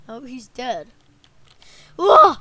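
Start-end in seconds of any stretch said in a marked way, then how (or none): 2.0-2.3 loud, high "whoa"; short mock shout; startled yell. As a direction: yelp out in play alarm. Figure cries out in battle.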